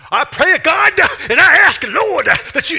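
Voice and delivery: very high, very loud preacher parody; televangelist. Acted. Preacher imitating televangelists praying on TV, shouting. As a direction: holler it like a hammed-up TV evangelist.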